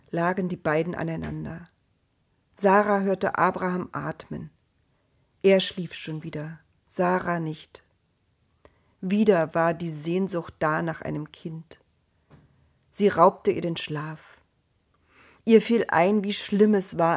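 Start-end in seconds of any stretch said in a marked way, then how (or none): none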